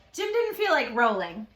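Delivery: usual speaking voice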